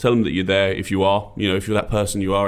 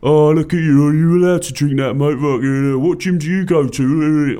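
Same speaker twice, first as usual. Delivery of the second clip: loud, higher laddish jibe; loud, higher blokey banter about the gym; mocking pub bloke; 6 semitones higher, 6 dB louder than usual. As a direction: rib a fit guy loudly at the bar. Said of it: "the bloke going".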